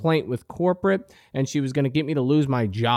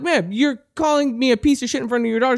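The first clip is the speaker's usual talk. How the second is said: fast, high-pitched retold protest; heated protest; 9 semitones higher, faster than usual. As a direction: replay an indignant objection at speed. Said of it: "It got really heated".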